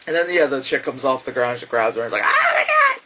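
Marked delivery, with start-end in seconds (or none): 2.2-3.0 very high, loud shriek; mock terrified scream. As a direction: scream it as the grabbed sister. Acting out grabbed sister.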